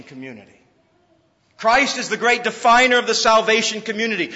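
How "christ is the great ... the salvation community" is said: repeated loud and high; full preacher's voice; preaching emphasis. Shown as a demonstration. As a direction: proclaim it this time. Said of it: "repeated louder".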